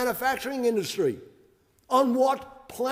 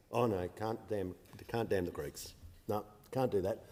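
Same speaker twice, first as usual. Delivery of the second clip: mock timid refusal; 12 semitones lower, 10 dB quieter than usual. Mocking opponents' timid refusals.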